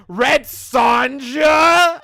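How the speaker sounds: very high, loud mock disbelief; imitated outraged shout. Host imitating everyone's reaction to the name, mock-outraged shout. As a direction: bellow it like an appalled crowd. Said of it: "everyone was like".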